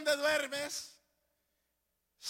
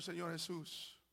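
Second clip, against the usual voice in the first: normal talking voice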